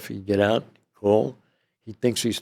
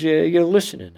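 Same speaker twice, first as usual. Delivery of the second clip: high, louder acted retort; indignant protest; 8 semitones higher, 5 dB louder than usual. Inmate reminds agents they tapped the calls, indignant. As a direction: push the point back at them.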